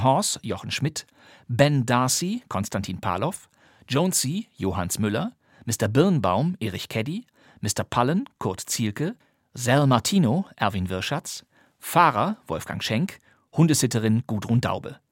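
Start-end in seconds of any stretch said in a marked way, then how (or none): none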